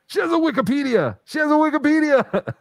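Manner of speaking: high-pitched, loud crowing; high, teasing boast; gleeful taunt. Real, not acted. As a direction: crow it in a high voice, breaking into a laugh. Gleeful taunt about Jake lacking one.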